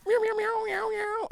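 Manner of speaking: high, silly cat meows; mock meowing. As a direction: meow like a playful cat. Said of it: "it wasn't like that".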